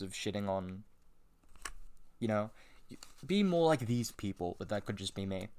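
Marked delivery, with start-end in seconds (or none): none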